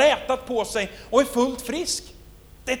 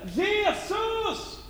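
ropar igen, högt; calling out; 9 semitones higher, 8 dB quieter than usual. Acted. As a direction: call out again, high pitched. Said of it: "Så han fortsätter". (ropar förtvivlat efter Jesus)

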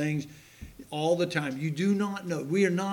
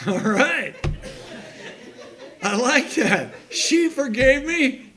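loud laughing joy, slower, higher; preacher laughing with joy, louder and higher; laughing delight; 7 semitones higher, 7 dB louder, slower than usual. Real, not acted. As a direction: laugh it out with happiness.

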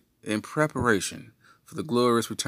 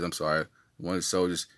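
ordinary talk, nothing marked

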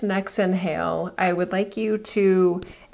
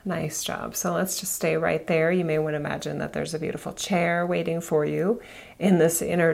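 guided meditation; 3 semitones lower, 3 dB quieter than usual. Acted. Guides elevator visualization meditation.

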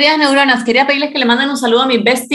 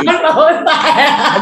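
laughing; 5 semitones higher, much slower than usual. Real, not acted. Laughing at the voices.

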